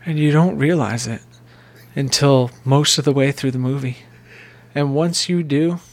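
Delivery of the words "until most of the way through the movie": ordinary talk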